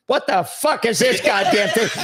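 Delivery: laughing through a mock furious Kennedy rant; cursing like an angry president, half laughing. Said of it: "you see him pick up the phone".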